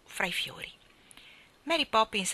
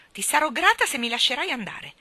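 huffy rebuke; 6 semitones higher, 6 dB louder than usual. A narrator's reading. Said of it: "ella sbuffò".

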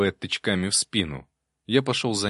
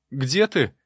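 higher, alarmed call; crying out; 6 semitones higher, 4 dB louder than usual. A narrator's reading. Frightened novice to an unseen voice, crying out. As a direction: call out sharply, pitch up. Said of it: "выкрикнул он".

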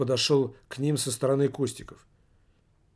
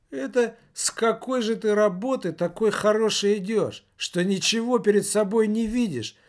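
high-pitched, scolding indignation; indignant woman; 7 semitones higher than usual. A narrator's reading. Woman in the group to a drunk, indignant. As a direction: raise the pitch and tell him off. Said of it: "возмутилась одна из женщин".

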